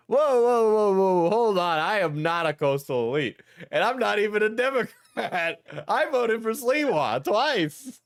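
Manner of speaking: mildly panicked backpedal; scared blurt. Acted. Diesel blurts out to the angry Shallot, mildly scared. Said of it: "Scared, Diesel blurted out".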